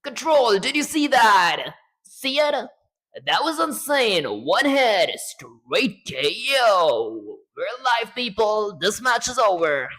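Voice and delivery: loud, high hyped radio voice; Shutter Punch voice. Acted. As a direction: scream it like a hyped caller. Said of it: "Shutter Punch, your version".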